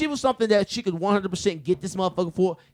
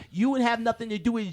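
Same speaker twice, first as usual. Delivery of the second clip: normal talking voice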